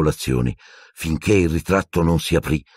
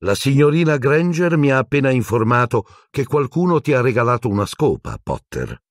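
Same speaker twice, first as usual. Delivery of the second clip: high brisk stern teacher, no preamble; strict professor getting straight to it; 8 semitones higher than usual. A narrator's reading. McGonagall confronting Harry about the broom, brisk.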